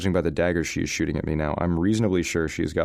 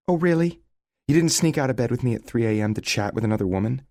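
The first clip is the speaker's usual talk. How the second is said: higher, biting sarcasm; sarcastic accusation; 7 semitones higher, 4 dB louder than usual. A narrator's reading. Sarah accusing Leo of cheating, sarcastic anger. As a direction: throw it back at him.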